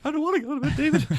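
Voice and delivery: whiny protest. Retold or imitated. Whining back at imagined coach.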